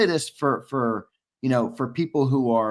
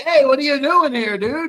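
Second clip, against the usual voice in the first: high, louder burst of surprise; surprised greeting; 11 semitones higher, 5 dB louder than usual. Real, not acted. Host spots an unexpected visitor here, high surprised greeting. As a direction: call out in real surprise, pitch way up.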